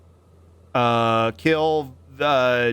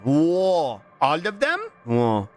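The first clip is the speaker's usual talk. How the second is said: ordinary talk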